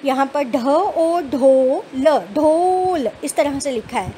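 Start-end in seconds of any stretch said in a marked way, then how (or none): 0.1-3.1 each sound said apart, slowly; teacher spelling syllables slowly for a class. Shown as a demonstration. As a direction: sound out the letters one by one for children. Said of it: "first picture देखिए".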